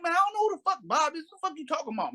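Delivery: high-pitched imitation of a baffled woman; mock indignant woman. Voices the woman protesting.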